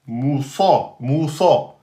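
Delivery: "Moussa" with ou and heavy s; teacher model; model word. Shown as a demonstration. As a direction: say "Moussa" clearly twice. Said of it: "entre deux emphatiques".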